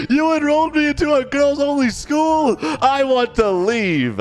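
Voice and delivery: put-on high-pitched, indignant kid protest; outraged son. Son objecting to being enrolled in a girls only school, outraged.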